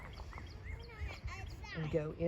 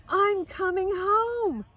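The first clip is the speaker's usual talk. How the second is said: much higher, louder and slow, savouring each word; overjoyed; 11 semitones higher, 10 dB louder, slower than usual. A narrator's reading. Lydia Grace telling family she's coming home, overjoyed. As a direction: announce it like the best news ever. Girl learns she returns home.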